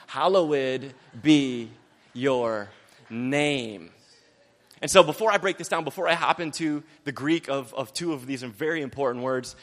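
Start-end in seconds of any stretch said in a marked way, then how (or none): 0.1-3.9 hallowed be your name, stretched out slowly; reverent slow reading. Shown as a demonstration. Preacher reading the prayer slowly to the congregation. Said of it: "This is hallowed".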